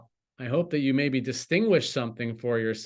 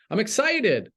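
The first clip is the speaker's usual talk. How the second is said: excited; 7 semitones higher than usual. Shown as a demonstration. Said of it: "I'm depressed, that's a way of being".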